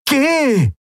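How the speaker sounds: high, sharp order allowing no reply; the Shayang orders Endriel away, high and sharp; sharp command. A narrator's reading. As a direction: cut off any protest. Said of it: "befahl die Shayang".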